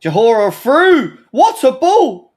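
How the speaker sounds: loud, slow, drawn-out call of the pass; excited loud call. Real, not acted. Fan calling out a through ball loud and slow.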